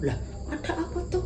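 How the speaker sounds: put-on quick, puzzled girl's voice; curious friend asking what's wrong; curious Dinda. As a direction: ask fast, a bit baffled.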